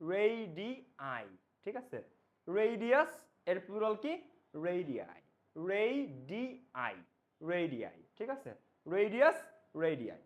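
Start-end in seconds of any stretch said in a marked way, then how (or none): 0.1-1.3 রেডিয়াই slowly as ray-dee-eye; slow রেডিয়াই ending in a clear eye; pronunciation demo. Shown as a demonstration. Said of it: "এটার pronunciation কি". 5.7-6.9 slow ray-dee-eye for রেডিয়াই; drawn-out রেডিয়াই with an eye ending; pronunciation demo. Shown as a demonstration. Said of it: "এটার pronunciation কি".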